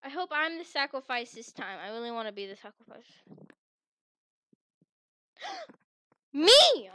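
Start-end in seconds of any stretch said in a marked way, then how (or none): 5.4-6.9 high loud gasp of delight; gasp, then burst out thrilled; excited gasp. Real, not acted. Excited to be chosen sacrifice.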